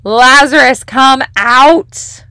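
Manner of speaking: "Lazarus, come out" called out loud and high. A narrator's reading. Jesus summoning Lazarus from the tomb, loud call. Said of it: "Jesus called in a loud voice".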